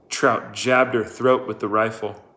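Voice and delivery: ordinary delivery, nothing marked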